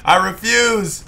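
loud, high defiant refusal; defiant shout. Pretend.

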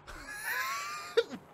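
very high startled laughing shriek; 尖叫般大笑. Real, not acted. Scared by monster.

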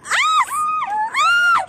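high startled scream; frightened scream. Real, not acted. Scared shout on high ride.

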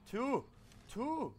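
high loud indignant shriek; outraged disbelief. Real, not acted. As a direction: squeal in disbelief at it. Outraged at tiny reward.

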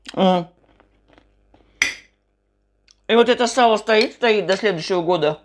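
speaking between bites; talking while chewing. Real, not acted. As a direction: mouth partly full. Seen 0: Fork to mouth at -1.0; closed-lip chewing, then talks over food.

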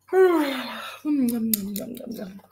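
long drawn-out yawn. Acted. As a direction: act out a big yawn.

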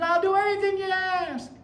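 high, loud eager promise; man on the cliff promises God anything; desperate promise. Retold or imitated.

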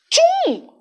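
sudden high crunching sound; loud tchum sound effect. A narrator's reading. Narrator voicing the giant's bite, high.

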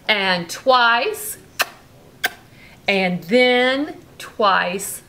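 lively bedtime storybook reading; reading to a child. A narrator's reading. Parent reading a bedtime story to Brooklyn, lively. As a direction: read it like a bedtime story to a little one. Reading picture book aloud.

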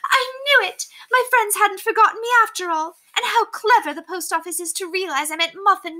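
high thrilled society lady; gleeful. Acted. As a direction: gush like she's dancing round the room. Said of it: "fairly danced around the parlor".

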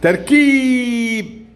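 تركيب stretched very slowly sound by sound. Shown as a demonstration. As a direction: spell out تركيب slowly for the pupil. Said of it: "ما نوع المادي في كلمة تركيب". (تركيب ببطء شديد حرفا حرفا)

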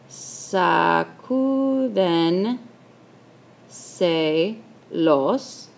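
"sacúdenselos" stretched syllable by syllable; very slow dictation. Shown as a demonstration. Teacher writing the example, "sacúdenselos" slowly drawn out. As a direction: draw out "sacúdenselos", pausing before the last syllables.

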